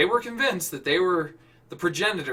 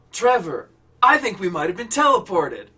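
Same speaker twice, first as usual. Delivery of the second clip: amazed; 3 semitones higher, 7 dB louder than usual. Acted. Amazed Nick.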